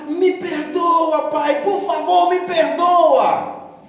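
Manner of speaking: high, loud, slow wailing plea; crying out for forgiveness. Retold or imitated. Crying boy shouting to his father, slow desperate wail. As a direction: wail the plea, stretching each word. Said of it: "eu comecei a gritar".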